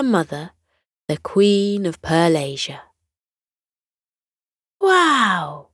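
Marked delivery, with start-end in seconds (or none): 4.8-5.7 higher gasping wow in awe; little dinosaur gasps in wonder; gasped wow. A narrator's reading. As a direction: gasp at the sight of royalty. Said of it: "the dinosaur gasped".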